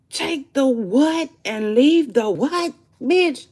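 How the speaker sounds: high, loud, slow outrage; outraged shout. Real, not acted. Shouting her disbelief at the viewers, outraged. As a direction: yell it in disbelief.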